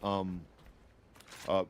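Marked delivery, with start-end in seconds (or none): none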